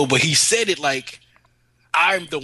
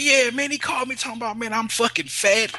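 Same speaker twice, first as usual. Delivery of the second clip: higher, quicker mock-outraged retelling; aggrieved friend; 9 semitones higher than usual. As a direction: play the offended buddy, a bit faster.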